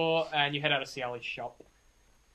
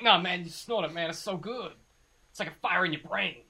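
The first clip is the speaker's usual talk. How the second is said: higher, enthusiastic stoner addict; eager druggie; 5 semitones higher than usual. Acted. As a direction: rave about your favourite drug. Game master voices the captive.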